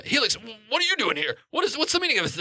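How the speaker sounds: high, bewildered questioning; acted nervous bluster; flustered demand. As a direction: demand answers in rising alarm. Game master voices flustered captive.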